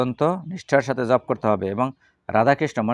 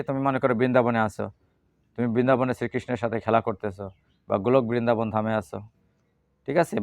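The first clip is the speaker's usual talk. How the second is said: ordinary delivery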